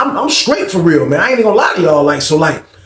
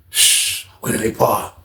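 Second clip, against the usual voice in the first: softer, breathy shush then a hushed question; 5 semitones lower, 6 dB quieter, much slower than usual. Retold or imitated. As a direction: keep it down so nobody hears. Hushing while sneaking in.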